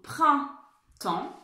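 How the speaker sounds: printemps drawn out very slowly while writing; slow sounding-out for a class; slow spelling-out. Shown as a demonstration. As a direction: stretch each sound as you write it. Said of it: "je l'écris à mon tour".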